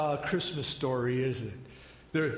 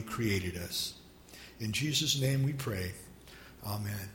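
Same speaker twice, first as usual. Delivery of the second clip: prayer; 5 semitones lower than usual. Real, not acted. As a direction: pray it unhurried, with pauses. Pastor leads opening prayer.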